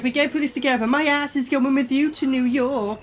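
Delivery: lightly mock the boyfriend as a dweeb. Reacting to the boyfriend in the film, mocking him as a dweeb. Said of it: "He's like".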